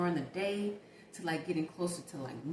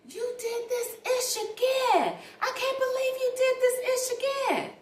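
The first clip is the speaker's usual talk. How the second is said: much higher, louder yelling woman; neighbor yelling; 7 dB louder than usual. Retold or imitated. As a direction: shout it like the upset neighbor through the wall. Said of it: "I hear her say".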